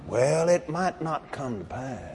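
low, soft mock doubter; doubtful hedging. Preacher imitating someone afraid to confess, doubtful hedging. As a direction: mutter the doubt low and hesitant.

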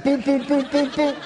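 playful knocking sounds; knocking noises. Retold or imitated. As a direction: mouth knocking boop boop. Knocking again.

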